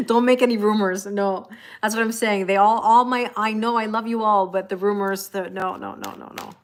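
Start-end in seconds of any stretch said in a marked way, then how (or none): none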